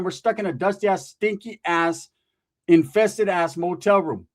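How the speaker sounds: usual speaking voice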